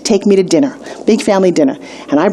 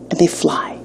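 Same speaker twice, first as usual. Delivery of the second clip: breathy whisper, much softer; whispered climax; 4 semitones lower, 5 dB quieter than usual. Acted. Storyteller whispers the leap's climax to her students. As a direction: let "they fly" fall into an awed whisper.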